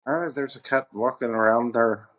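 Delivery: lightly voiced Irish accent, cat with a held T; a hint of an Irishman saying cat. Friend imitates an Irishman saying cat, light Irish accent. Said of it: "how an Irishman would say cat".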